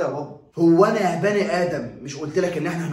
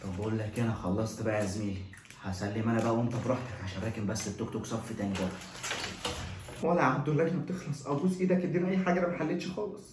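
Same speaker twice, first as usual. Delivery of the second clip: much softer hushed talk; whispered exchange; 3 semitones lower, 6 dB quieter than usual. Acted. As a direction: keep it low so nobody hears. Whispered cheating in exam.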